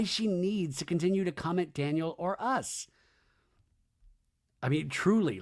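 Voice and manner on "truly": usual speaking voice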